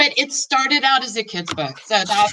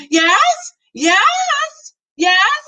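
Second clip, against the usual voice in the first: much higher, louder calls across the house; calling out; 12 semitones higher, 5 dB louder, much slower than usual. A narrator's reading. Mother calling down to the basement, loud high calls. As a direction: call out from another room. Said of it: "my mom calls out".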